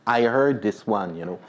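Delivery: ordinary delivery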